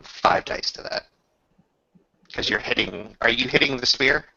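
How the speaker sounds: ordinary talk, nothing marked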